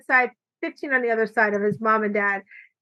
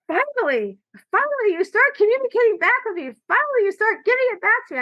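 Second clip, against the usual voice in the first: much higher, put-on gleeful uncle voice; jovial uncle; 10 semitones higher than usual. Joking uncle cheers niece for talking back, high gleeful voice. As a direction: crow it like the delighted jokester uncle.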